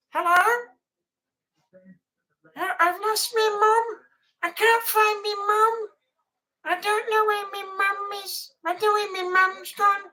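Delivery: put-on high, childlike voice of a lost kid; Tiny Tim impression. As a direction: whimper like a child who lost his mum. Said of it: "I can impersonate him".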